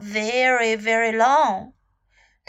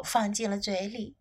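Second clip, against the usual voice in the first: plain reading voice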